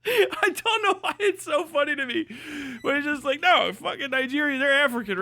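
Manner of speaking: high giggly incredulous; laughing, can barely say it; laughing disbelief. Real, not acted.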